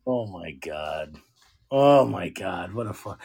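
low, slow, drained oh my God; a real weary groan; exasperated groan. Real, not acted. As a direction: sink into disbelief.

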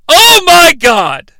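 high, loud, drawn-out exclamation; exasperated outcry. Real, not acted. Host recaps an absurd scene, exasperated outcry. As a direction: cry out in comic disbelief.

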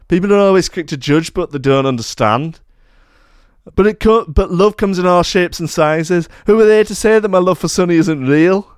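high, forceful mock Birmingham accent; Brum accent. [name] defending his love for Sonny, in a mock Brum accent. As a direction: plead the case in hammed-up Brummie. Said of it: "my Brum accent was more".

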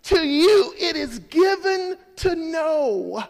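high, loud, slow proclamation; preaching at full force. Real, not acted. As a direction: shout the promise to the crowd. Repeats verse loudly.

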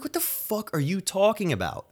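high-pitched disbelief, retold; incredulous. Retelling reaction to coworkers' warning, high incredulous. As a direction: pitch shoots up in stunned disbelief.

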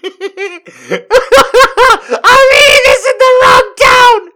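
very high, loud shriek; hysterical squeal. Real, not acted. Reader joking about the man, high laughing shriek. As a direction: shriek it through a laughing fit. Cracking up at the story.

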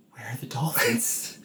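hushed whisper. Retold or imitated. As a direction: whisper it worried. Kay's line retold.

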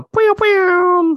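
high, loud toy-gun pew; pew pew sound. Pretend. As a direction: zap it out like a laser gun. Rating joke, sound effect.

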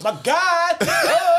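high, loud mock-excited holler; hammed-up greeting. Host hailing friend who turns up late, hammed-up excitement. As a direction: cry out the name, hamming it up, breaking into laughter.

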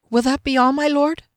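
high, timid servant's question; timid maid's murmur. A narrator's reading. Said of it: "she murmured".

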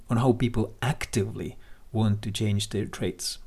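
ordinary talk

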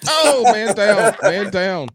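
loud, high "man down" call, slowed at the start; mock alarm call. Real, not acted. Co-host jokes when the guest's camera falls, loud mock alarm. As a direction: sound the alarm like a soldier down.

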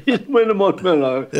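a hint of drawling rock singer, played for laughs. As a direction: repeat the joke in a comic impressionist's voice. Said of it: "doing an impression of him".

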